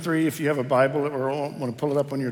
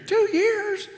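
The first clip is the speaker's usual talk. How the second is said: put-on high, astonished old woman's voice; confused elderly mother; 3 dB louder than usual. Pastor voicing his confused mother in shock. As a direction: squeak it out like a bewildered old mom.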